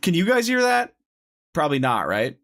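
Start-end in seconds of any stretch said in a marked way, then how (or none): 0.0-0.9 high-pitched. Real, not acted. Checking audio with chat.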